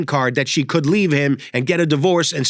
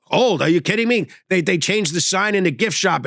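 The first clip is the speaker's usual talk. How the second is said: normal talking voice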